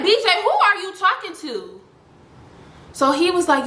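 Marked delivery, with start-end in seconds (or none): 0.0-1.8 high-pitched retold challenge; confronting question. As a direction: call him out, voice pitched well up. Acted confrontation of brother on call.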